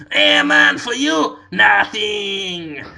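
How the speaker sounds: mellow, generous stoner shopkeeper; Cheech Marin voice again. Retold or imitated. Shopkeeper offering the flower free, mellow stoner voice. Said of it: "He goes".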